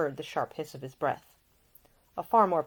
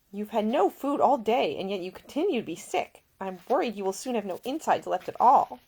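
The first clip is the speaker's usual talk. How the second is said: higher, brighter-pitched caring lady; a young woman tending a seasick man, solicitous and higher; Ida, gentle young woman; 5 semitones higher, 3 dB louder than usual. A narrator's reading. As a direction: voice the attentive young lady nursing him. Ida speaking.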